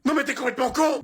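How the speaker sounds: high-pitched, indignant cry; appalled, voice shooting up; outraged outburst. Acted. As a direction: explode at his stupidity. Reacts to the fatal mix-up.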